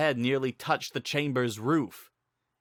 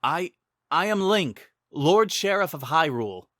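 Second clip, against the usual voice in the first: higher, louder, stammering declaration; nervous hero Link; 6 semitones higher, 5 dB louder than usual. Acted. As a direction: force out a brave name through fear. Narrator voices Link, stammering.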